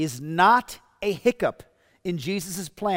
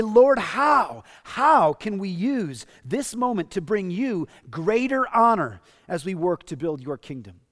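a louder, heartfelt model prayer; 2 semitones higher, 4 dB louder than usual. Pretend. Pastor modelling a prayer for the congregation, louder and earnest. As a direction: lift it up as an earnest plea to the Lord. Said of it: "we should pray".

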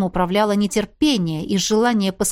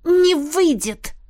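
slow, higher mocking retort; smirking; 7 semitones higher, much slower than usual. A narrator's reading. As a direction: smirk it out defiantly. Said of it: "усмехнулась я".

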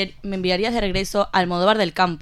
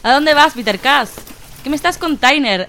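higher, louder indignant outburst; outraged shout; 5 semitones higher, 5 dB louder than usual. Acted. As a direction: yell after him in disbelief. Outraged at fleeing wizard.

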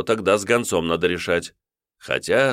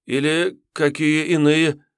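высоко, медленно, недоумевая; повторить не понимая; растерянный отрок; 10 semitones higher, 3 dB louder, slower than usual. Acted. (отрок растерянно переспрашивает)

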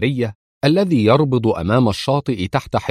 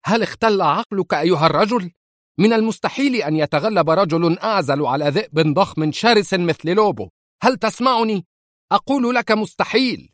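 much higher, raging yell; furious shout; 10 semitones higher than usual. Acted. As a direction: scream at him in disbelief. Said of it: "يصيح بغضب عارم". (صراخ غاضب عارم)

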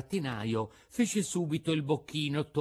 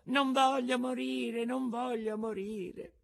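high, slow frightened wail; a terrified puppet begging for his life; Pinocchio screaming; 13 semitones higher, slower than usual. A narrator's reading. Pinocchio begs the puppet master not to die, high frightened wail.

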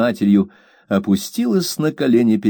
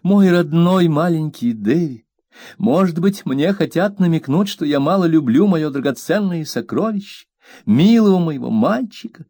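high, aggrieved plea; mother scolding her maid, high and hurt; wounded young mother; 8 semitones higher than usual. A narrator's reading. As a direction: play the hurt, fragile mistress. Mother voiced, hugging son.

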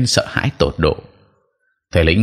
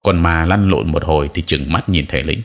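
ordinary delivery, nothing marked

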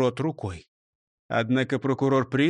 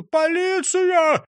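high, loud cry for help; indignant, high-pitched hollering; yelling; 13 semitones higher, 4 dB louder than usual. A narrator's reading. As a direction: scream for the police in outrage. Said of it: "И тут он завопил".

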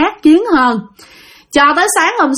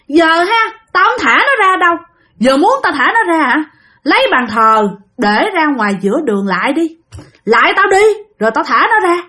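higher-pitched, demanding spirit; possessed aunt; 4 semitones higher than usual. Retold or imitated. Spirit bargains with the family over the boy, possessed voice. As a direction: make demands as the spirit inside her. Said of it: "thím ba mới đứng lên mới nói".

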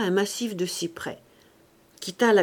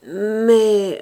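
feeble protest; 8 dB louder than usual. A narrator's reading. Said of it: "reprit-il faiblement".

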